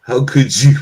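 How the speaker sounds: mock outrage.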